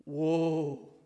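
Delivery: soft drawn-out "Whoa" of wonder; awed whoa. Shown as a demonstration. Pastor marveling at John's new creation, softly awed. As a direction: let a quiet "Whoa" escape in awe. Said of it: "so whoa-like here".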